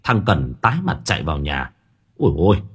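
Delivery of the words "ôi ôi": startled, panicky exclamations; horrified cry. A narrator's reading. Servant runs in from the stable, crying out in horror. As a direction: cry out in short, alarmed bursts. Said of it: "nó hét lên thất kinh".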